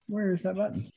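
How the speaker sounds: ordinary talk, nothing marked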